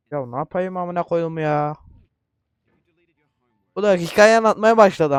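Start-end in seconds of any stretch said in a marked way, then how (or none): 3.7-5.2 loud, higher-pitched complaint; exasperated outburst. Real, not acted. Gamer fed up with the storytelling, exasperated outburst. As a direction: complain loudly over someone else's chatter. Annoyed at game's narrator talking.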